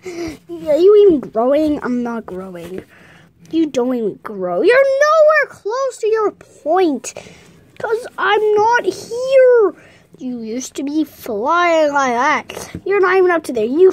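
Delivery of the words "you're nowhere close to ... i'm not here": high, slow, drawn-out taunting; high-pitched bickering; squabbling protest. Acted. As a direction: stretch the words out in a raised voice. Squabbling over height and growth.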